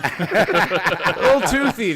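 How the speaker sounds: laughing. Real, not acted. Answering friends about the Frenchman, laughing slowly. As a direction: drag it out through laughter. Joking about the blow job.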